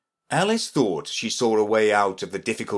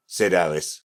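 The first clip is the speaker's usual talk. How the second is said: ordinary delivery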